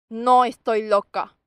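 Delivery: slow, loud, acted anger; angry retort. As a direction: say it slowly and forcefully, in anger. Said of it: "Mirándola con enojo, le dije".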